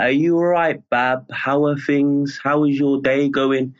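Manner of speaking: slow Birmingham accent. Shown as a demonstration. Guest showing how Birmingham people greet, slow Birmingham accent. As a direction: stretch it out in leisurely Birmingham speech. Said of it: "we generally speak quite slow".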